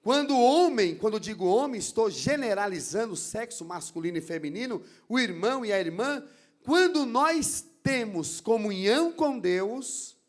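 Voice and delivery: loud, emphatic sermon delivery building in force; forceful preaching. Real, not acted. Preacher warning the church about the enemy of God, forceful preaching. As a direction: preach it out loud to the congregation, driving each point home.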